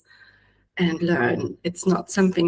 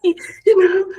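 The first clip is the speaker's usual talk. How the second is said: higher, forced cheery hey; forced high-pitched greeting; 12 semitones higher, 4 dB louder than usual. Retold or imitated. Greeting her Instagram viewers, in a forced high pitch. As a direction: put on a bright fake hello. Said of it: "I would try to go high pitched and be like".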